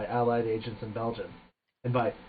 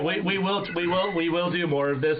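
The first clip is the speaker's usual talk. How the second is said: ordinary talk, nothing marked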